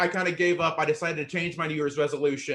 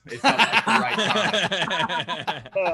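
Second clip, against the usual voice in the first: laughing; 4 semitones higher, 5 dB louder, much slower than usual. Real, not acted. Slow laughing punchline.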